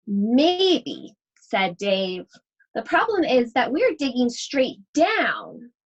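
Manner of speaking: put-on lively read-aloud voice for children; story reading. Teacher reading a picture book to her class, animated storytelling.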